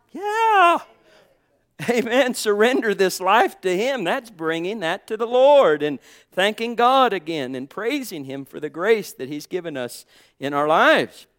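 high, loud, ringing preacher's cry; full-force preaching. Acted. Pastor exhorting the congregation, loud full-force preaching. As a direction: preach it out to the whole church.

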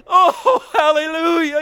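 high, loud tearful hallelujah; joyful crying shout. Real, not acted. Preacher overwhelmed by grace, tearful shout. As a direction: burst out with weeping joy.

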